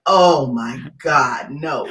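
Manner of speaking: slow, dragged-out horror; a touch of frozen fear; mock dread. Storyteller seeing gun at her face, mock dread. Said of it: "I'm like".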